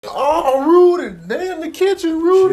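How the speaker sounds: high, loud calling out to Rudy; alarmed call. Acted. Cat calls out alarmed.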